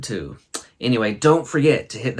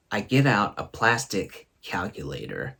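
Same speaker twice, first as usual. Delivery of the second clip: ordinary talk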